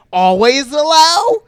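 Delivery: loud, high, drawn-out question; hammed-up app prompt, stretched and high; mock incredulous shout. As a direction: blare it out in rising disbelief. Acts out app's repeated permission question.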